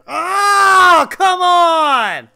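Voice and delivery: high, loud wailing yell; anguished shout. Real, not acted. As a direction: cry out in real frustration. Gamer raging at game.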